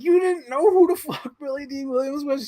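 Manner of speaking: high, laughing disbelief; can't believe it, high; incredulous. Retold or imitated. Shocked at sister.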